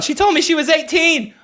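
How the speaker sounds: high, panicked blurt; high-pitched guilty protest; mock panic. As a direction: blurt it like a man caught out. Acts Bobby's panicked guilty blurt.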